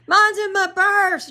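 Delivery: high, loud call back from the rain; retold yell. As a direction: yell it back over your shoulder while running. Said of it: "And Jill yells".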